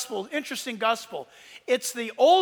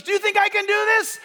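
stuntman asking crowd; 8 semitones higher, 4 dB louder, faster than usual. Retold or imitated. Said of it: "He goes".

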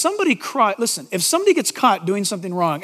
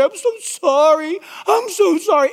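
mock whiny apology; 6 semitones higher than usual. Mocking shallow apology.

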